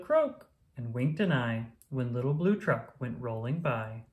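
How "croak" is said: toad's croak. Acted. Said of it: "Toad said".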